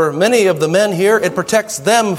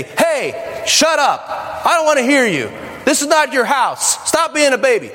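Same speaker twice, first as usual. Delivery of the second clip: put-on higher, harsh scolding of a toddler; mock scolding; 7 semitones higher than usual. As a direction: bark at the whining child. Acting rude stranger scolding toddler.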